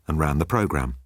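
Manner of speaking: ordinary delivery, nothing marked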